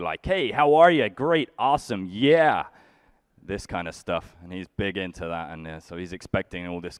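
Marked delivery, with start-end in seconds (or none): 0.3-2.7 high, loud, overly cheery American hello; chirpy American greeting. Retold or imitated. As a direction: gush like a friendly American. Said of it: "So he's used to".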